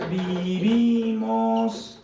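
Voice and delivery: very drawn-out syllables; held long, as if writing along; slow dictation. Real, not acted. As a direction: stretch the word out while writing it. Slowly dictating sentence while writing it.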